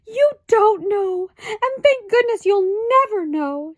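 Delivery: high, slow, crying mother's voice; high tearful lament, slowly. A narrator's reading. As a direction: weep over her poor child. Mother weeping over her child.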